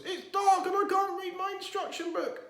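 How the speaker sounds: high small voice, muffled from inside a box; a little bear talking from inside a shut box. A narrator's reading. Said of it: "said a small and muffled voice".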